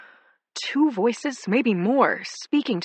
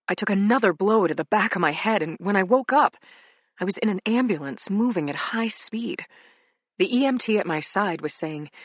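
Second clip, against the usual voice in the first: usual speaking voice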